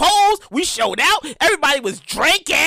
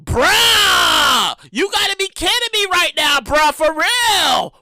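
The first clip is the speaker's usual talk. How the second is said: louder, drawn-out outraged yell; finding more people waiting, shouting in exasperation; exasperated shout; 3 dB louder, much slower than usual. Retold or imitated. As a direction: shout your disbelief at the queue. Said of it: "I was like".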